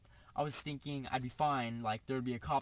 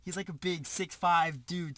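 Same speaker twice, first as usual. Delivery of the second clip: usual speaking voice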